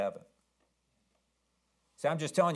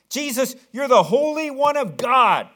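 much higher, much louder scream; 11 semitones higher, 11 dB louder than usual. Retold or imitated. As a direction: yell it at the top of your lungs. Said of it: "he screamed out at the top of his lungs".

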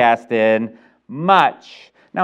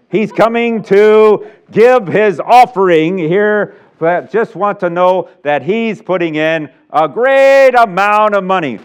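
louder, higher grand announcement; showy announcer; 6 semitones higher, 6 dB louder, slower than usual. Retold or imitated. Preacher announces Count John's offering in pompous style. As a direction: herald the big giver's entrance.

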